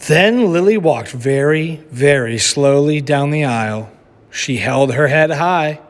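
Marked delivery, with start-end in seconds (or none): none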